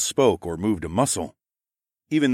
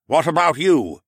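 stern questioning; 8 semitones higher, 6 dB louder than usual. Acted. Lord questions next man.

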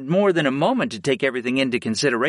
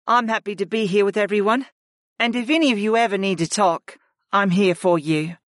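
higher, kind woman's voice; 8 semitones higher than usual. Acted. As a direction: offer support warmly, pitched up. Ashla offers support.

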